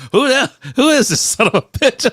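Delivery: high, loud voice of an indignant fish; startled fish. Acted. Fish looking up at people, startled and indignant. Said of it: "They look up, they go".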